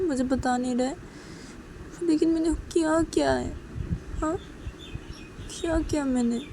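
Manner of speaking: fretting aloud in short, repeated questions; worried Uma. Acted. Uma wondering why Sanju is upset with her, worried. Voices Uma's anxious thoughts.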